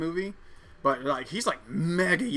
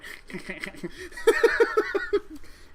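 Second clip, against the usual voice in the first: high falling laugh burst; real high laugh. Real, not acted. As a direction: laugh at your own quoting. Laughing after quoting scene.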